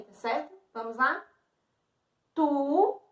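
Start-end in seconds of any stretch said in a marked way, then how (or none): 2.3-3.0 TU on its own, vowel held; single counted syllable. Shown as a demonstration. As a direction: sound out TU as one separate syllable. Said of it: "Pode bater palma também".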